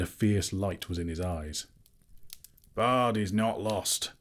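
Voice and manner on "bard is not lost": ordinary delivery, nothing marked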